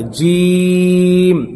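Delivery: جیم drawn out very long on one held note; slow, chanted, elongated جیم; stretched letter. Shown as a demonstration. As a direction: hold the "ee" of جیم steadily. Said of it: "جیم کو کھینچ کے پڑھنا ہے".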